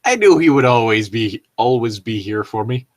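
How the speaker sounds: normal talking voice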